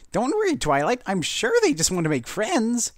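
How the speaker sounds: high, bright-eyed hopeful voice; hopeful Pinkie. A narrator's reading. Pinkie reassuring Twilight, high and hopeful.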